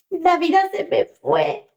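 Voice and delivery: high, slow, heartfelt distress; anguished. Real, not acted. Mother recalling her daughter's call, anguished. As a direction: let the remembered pain lift the voice.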